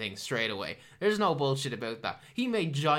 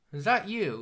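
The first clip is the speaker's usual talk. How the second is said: raised question; 6 semitones higher, 5 dB louder than usual. Retold or imitated. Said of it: "He's like".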